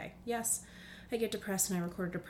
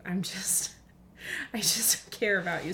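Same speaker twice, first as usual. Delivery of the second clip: ordinary talk, nothing marked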